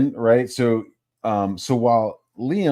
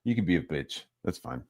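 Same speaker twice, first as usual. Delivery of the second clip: lower, softer, unbothered reply; resigned dismissal; 3 semitones lower, 7 dB quieter than usual. Retold or imitated. Streamer giving up on Ethan playing dumb, resigned. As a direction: let it go quietly, with pauses.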